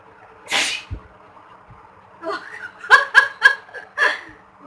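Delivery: a burst of loud high sneezes; sneezing. Real, not acted. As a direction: sneeze over and over. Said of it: "I'm gonna sneeze it again".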